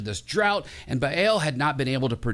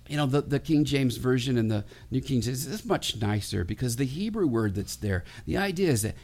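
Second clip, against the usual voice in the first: ordinary talk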